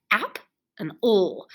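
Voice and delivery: "Ap", pause, then a full "ol"; separated syllables. Shown as a demonstration. Teacher names the two parts, "Ap and ol" sounded separately. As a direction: say "Ap and ol" slowly as two isolated parts. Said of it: "There are two syllables in this word".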